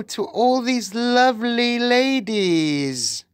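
high, slow voice dripping with syrup; syrupy witch. A narrator's reading. Witch inviting a boy up to greet the ladies, syrupy. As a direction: coax sweetly, stretching the words high. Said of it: "It was soft and gentle and absolutely dripping with syrup".

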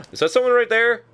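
high startled gasp and question. Real, not acted. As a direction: jump at a figure you spot. Spots enemy in game.

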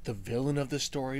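usual speaking voice

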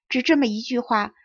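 ordinary delivery, nothing marked

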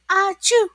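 high, loud storybook achoo; mock sneeze spoken as a word; acted sneeze. A narrator's reading. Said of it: "When SpongeBob sneezed".

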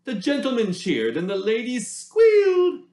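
ordinary delivery

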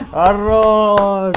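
loud high "nice!" shout; cheering shout. Real, not acted. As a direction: yell it in triumph. Cheering a good play.

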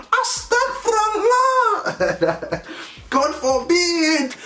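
very high, slow, laughing disbelief; laughing mock outrage. Real, not acted. Reacting to her flirting in front of her man, laughing. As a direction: clutch your pearls through laughter.

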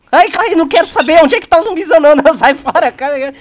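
loud, fast, high panicked cries; panicked shouting. Real, not acted. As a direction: yelp in panic at the zombie. Panicked yelling at game.

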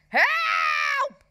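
piercingly high, loud cry for help; hammed-up drowning shriek; yelped. A narrator's reading. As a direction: yell as a flailing swimmer would. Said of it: "yelped Ralph, spluttering and flailing".

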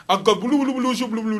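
high gibberish French patois babble; mock French patois accent. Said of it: "French patois accent".

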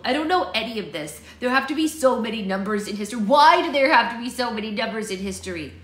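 exasperated complaint building to a loud wail; grumbling. A narrator's reading. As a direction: whine in frustration. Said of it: "he grumbled".

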